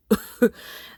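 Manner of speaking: high, bright laugh burst; burst of laughter. Real, not acted. Reader cracking up at the story, laughing. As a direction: laugh out loud at the line.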